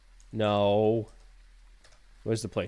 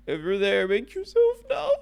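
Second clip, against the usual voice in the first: spooky medium voice; 9 semitones higher, 4 dB louder than usual. Pretend. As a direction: summon the ghost in a high voice. Calls out to the ghost.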